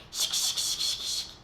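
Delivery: whispered ticking like tiny bugs; playful ticking of bugs in the mouth; bug nibbling noise. Pretend. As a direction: tease the child with a creepy-crawly sound.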